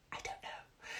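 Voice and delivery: barely audible whisper; hushed, confidential whisper; whispered aside. Real, not acted. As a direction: whisper it, almost no voice. Mock puzzled aside, whispered.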